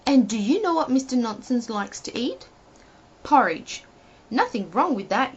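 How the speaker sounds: ordinary delivery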